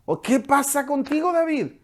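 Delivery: high, scolding voice of an exasperated general; preacher voicing Joab scolding the king, pitched high. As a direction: play Joab telling David off, up in pitch. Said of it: "y le dice".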